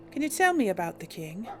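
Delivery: normal talking voice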